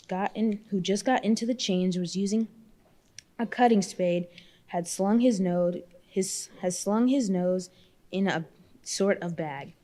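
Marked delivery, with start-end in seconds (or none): none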